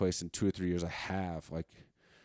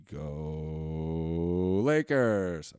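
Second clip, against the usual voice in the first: drawn-out cheer; 2 semitones lower, 7 dB louder, much slower than usual. Pretend. Half-joking sign-off cheer.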